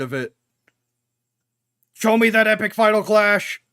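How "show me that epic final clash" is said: loud, high eager demand; loud excited hype; hyped. Real, not acted. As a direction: cheer the fight on. Hyped for the fight.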